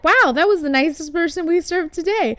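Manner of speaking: the staff marvelling at a kind customer; impressed barista. Retold or imitated. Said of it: "And they'll be like".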